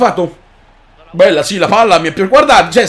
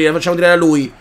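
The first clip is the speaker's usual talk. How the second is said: usual speaking voice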